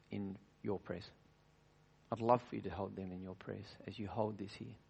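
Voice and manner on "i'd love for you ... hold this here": said again much softer, gently; softer, tender repeat. Shown as a demonstration. Preacher gently repeating the plea to the congregation, softly. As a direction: ease down and make the plea tender.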